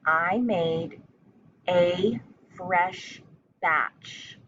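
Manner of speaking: chunked into short phrases with pauses between; teacher dictating a sentence; slow dictation. Shown as a demonstration. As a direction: dictate it piece by piece for young spellers. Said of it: "The first part of the sentence is".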